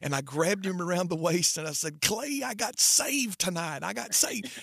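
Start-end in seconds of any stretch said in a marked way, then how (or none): 2.0-4.5 much higher and slower, bursting with joy; overjoyed announcement. Retold or imitated. Retelling telling his friend Clay he got saved, joyful. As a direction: announce it like the best news of your life.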